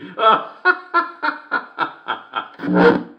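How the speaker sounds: high, long staccato laugh; uproarious laugh. Acted. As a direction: burst out high and keep laughing. Laughs at own joke.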